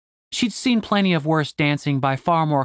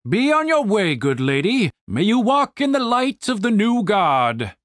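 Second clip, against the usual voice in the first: higher-pitched, stately blessing from an officer; solemn, projected courtly farewell; pompous commander; 6 semitones higher than usual. Acted. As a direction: send her off with grand piety.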